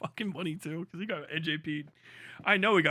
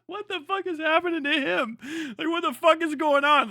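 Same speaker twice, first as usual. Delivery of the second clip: high alarmed outburst; 10 semitones higher, 9 dB louder than usual. Retold or imitated. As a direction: voice jumps high in shock. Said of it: "You're like".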